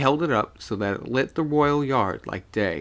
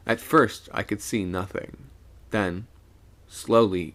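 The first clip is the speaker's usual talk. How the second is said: ordinary delivery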